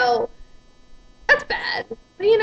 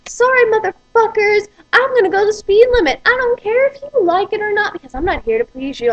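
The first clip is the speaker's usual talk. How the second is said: defiant rant; 8 semitones higher, 5 dB louder than usual. Real, not acted. Road rage rant at cars behind.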